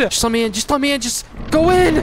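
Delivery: high, urgent begging; frantic pleading. Real, not acted. Player desperate to get inside, frantic high pleading.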